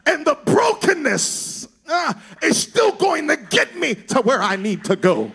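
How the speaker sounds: high, loud, chanted preaching over the organ; full-force preaching. Real, not acted. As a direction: preach it to the rafters with rising fervor. Preacher builds to full-force climax.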